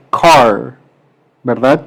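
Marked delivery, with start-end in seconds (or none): 0.1-0.7 car said slowly the English way. Shown as a demonstration. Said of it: "Se dice". (car pronunciado lento en inglés)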